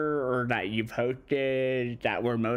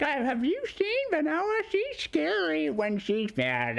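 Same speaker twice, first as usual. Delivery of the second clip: high, wary quoted line; a lightly voiced character quoting her fear. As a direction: warn them she's scary. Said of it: "the line I said as my own queen".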